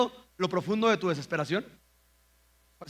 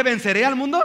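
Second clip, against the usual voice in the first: loud, higher declaration; triumphant proclamation; 6 semitones higher, 8 dB louder than usual. Acted. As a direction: proclaim it like a victory. Cita triunfal de Jesús. (proclamación triunfal, fuerte y aguda)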